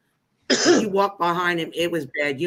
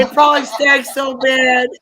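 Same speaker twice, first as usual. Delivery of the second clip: higher, louder, through real laughter; helpless laughing exclamation; laughing while talking; 5 semitones higher, 8 dB louder than usual. Real, not acted. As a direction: squeeze the words out while cracking up. Teller cracks up at own story.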